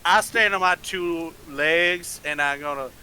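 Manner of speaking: put-on slow Southern accent, taking his time. [name] answers the party slowly in a Southern accent. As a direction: drawl it out like a slow Southern cowboy. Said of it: "Can't with this accent".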